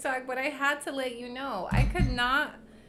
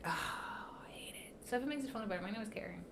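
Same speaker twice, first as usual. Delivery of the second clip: softer whispered confession; 9 dB quieter, faster than usual. Real, not acted. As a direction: whisper it like an embarrassing secret. Confessing her middle name to viewers.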